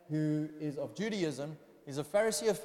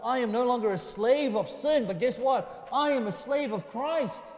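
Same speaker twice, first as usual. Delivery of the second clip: raised, ringing pulpit declaration; higher-pitched, emphatic sermon delivery; impassioned preaching; 5 semitones higher, 8 dB louder than usual. Real, not acted. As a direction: preach it with rising force and conviction. Preaches at full force.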